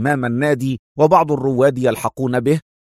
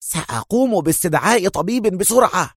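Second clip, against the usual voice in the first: exclaiming; 6 semitones higher than usual. A narrator's reading. Club member offering to fetch a doctor, exclaiming. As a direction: exclaim helpfully in a raised pitch. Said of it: "هتف أحد الأعضاء".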